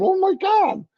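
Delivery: high-pitched disbelief; amazed gasp. Retold or imitated. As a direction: act stunned at the price. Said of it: "I'm going".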